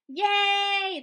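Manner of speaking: high, loud jubilant cheer. A narrator's reading. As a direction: cheer together. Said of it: "the crowd cheered".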